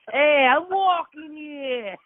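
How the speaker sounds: high, slow, loud mock New York yell; drawn-out New York pedestrian shout; New York accent. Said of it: "New York, it's like".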